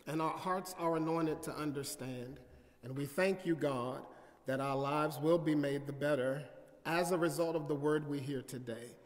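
soft, hushed opening prayer; quiet pastor's prayer before the sermon. Acted. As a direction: pray it low and reverent.

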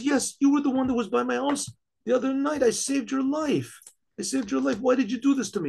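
high, incredulous protest; astonished recognition. Acted. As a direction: protest in amazement to the one you rescued. Man recognizes king he rescued.

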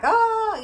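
high, loud frantic yelp; mock panic in one burst. Acting out her morning panic, a high frantic yelp. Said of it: "frantic and crazy".

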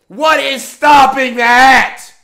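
loud, slow, deliberate demand; slow angry shout. Real, not acted. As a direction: hammer each word out. Outraged anime rant.

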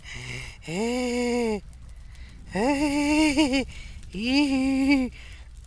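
long, high giggling; high, long giddy laugh. Real, not acted. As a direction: laugh like you're losing it.

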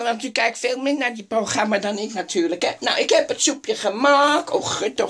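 ordinary talk

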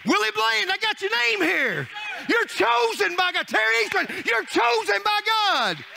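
preaching. Acted. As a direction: call out names like a revival preacher. Preaching at full force.

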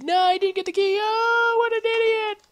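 very high, loud mock squeal; hammed-up shriek. Gamer sends up being caught without the key, mock shrieking. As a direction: shriek it in exaggerated falsetto. Acted outburst mocking map creators.

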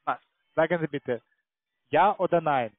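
ordinary talk, nothing marked

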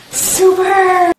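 quick gasp, then a loud high "Super!"; delighted shout. Real, not acted. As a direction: cheer as the piece fits. Tower fits, mum delighted.

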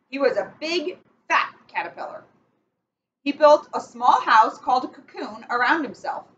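picture-book read-aloud, measured and clear; reading to children. Acted. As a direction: read it like bedtime story time for kids. Reads picture book to kids.

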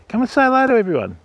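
high, fast sing-song invitation; high quick call to an emu to greet the stream; coaxing pet call. Real, not acted. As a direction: chirp it to the bird like a friendly host.

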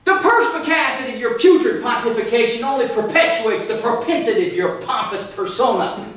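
loud, pompous declaration; hammed-up comeback. Storyteller hurling his big insult at Pete, loud and pompous. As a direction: hit every P hard.

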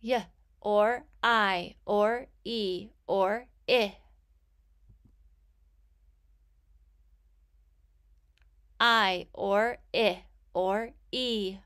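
"Y", "I" and "E" as slow, separate sounds; very slow, measured sounding-out of "I" and "E"; sounding out letters. Shown as a demonstration. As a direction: list each sound with a drawn-out "or" between. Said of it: "I will say the sound".

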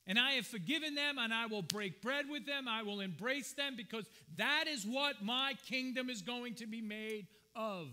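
high, loud, ringing declaration as God; impassioned divine proclamation. Acted. Preacher voices God welcoming back sinners, impassioned proclamation. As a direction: proclaim it at full force, pitched high.

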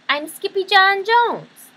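high, loud, drawn-out declaration; proud kitten. Acted. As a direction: announce yourself proudly in a high voice.

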